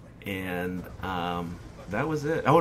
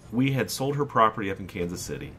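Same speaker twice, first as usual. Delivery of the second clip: ordinary talk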